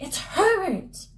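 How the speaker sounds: high, drawn-out cry of joy; excited realization. Acted. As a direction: cry out the name, pitch soaring. Mother thinks dead son returned.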